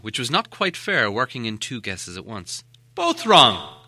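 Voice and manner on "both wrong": relieved cry, higher and louder; cried out. Acted. Bilbo answers Gollum's guesses, relieved loud cry. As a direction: call it out in triumphant relief. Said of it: "cried Bilbo".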